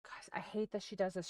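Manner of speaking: hushed whisper while hiding. Real, not acted. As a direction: whisper so he won't hear.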